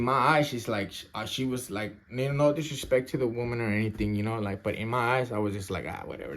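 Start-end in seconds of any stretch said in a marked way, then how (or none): 5.9-6.4 very low, soft brush-off; couldn't-care-less, pitched way down; dismissive. Retold or imitated. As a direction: shrug it off quietly. Brushing her off as unimportant.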